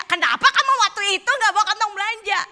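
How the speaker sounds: high, loud indignant demand; nagging mother. Acted. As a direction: mimic a mother loudly dredging up an old grievance. Mother still nagging at the wedding.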